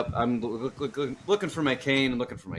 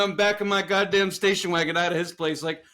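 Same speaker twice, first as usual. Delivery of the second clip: ordinary talk, nothing marked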